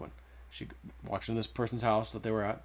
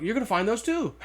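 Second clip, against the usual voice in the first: much higher and louder, tumbling with delight; excited; 12 semitones higher, 5 dB louder than usual. Retold or imitated. Old man revealing more gold up there, excited. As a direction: gush about the treasures still waiting. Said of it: "kind of excited for me".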